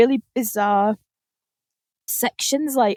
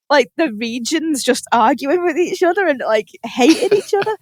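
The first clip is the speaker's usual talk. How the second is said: usual speaking voice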